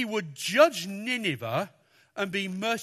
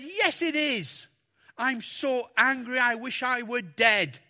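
petulant Jonah; 5 semitones higher, 4 dB louder than usual. Acted. Jonah's angry reply to God.

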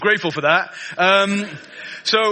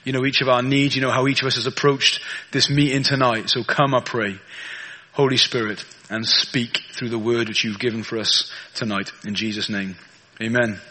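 prayer; 7 semitones lower than usual. Acted. Prays before the sermon.

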